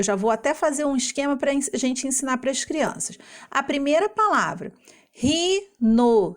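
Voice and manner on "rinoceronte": "rinoceronte" slowly as ri-no-ce-ron-te; five separate beats in "rinoceronte"; one syllable at a time. Shown as a demonstration. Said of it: "pronunciando uma sílaba de cada vez".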